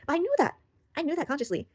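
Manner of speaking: high, loud burst easing off; exasperated protest. Real, not acted. Woman exasperated at what she already knew, high protest. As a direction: protest it in a high-pitched outburst.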